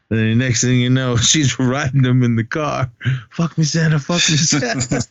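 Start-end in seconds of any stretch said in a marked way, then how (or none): 3.3-5.0 breathy high mock moan from the woman, a bit hammed-up. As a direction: play the woman moaning it, half joking. Retelling the woman in the car.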